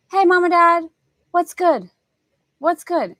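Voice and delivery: chirpy son greeting parents on the phone; imitating son. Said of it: "That's how he, uh, talks on the phone".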